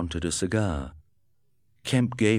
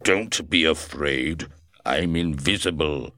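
invisible man's voice; 2 semitones lower, 3 dB louder than usual. A narrator's reading. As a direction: speak as the unseen Griffin. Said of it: "the voice said".

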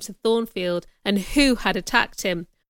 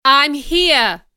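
loud, raised-pitch answering call; 5 semitones higher, 7 dB louder than usual. A narrator's reading. Rochester answers the alarmed guests, calling out. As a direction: call back to the crowd.

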